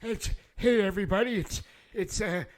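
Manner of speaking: put-on high, loud creaky old geezer; old man voice. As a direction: play a cranky deaf old man. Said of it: "I was in the middle of doing my old man voice".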